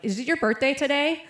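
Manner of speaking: nervous blurt. Retold or imitated. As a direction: imitate a friend nervously blurting it out. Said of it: "sort of nervously blurts out".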